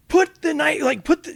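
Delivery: high, loud yell to drop it; shouted command. Retold or imitated. Officer yelling at woman to drop knife, loud command. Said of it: "So I'm like".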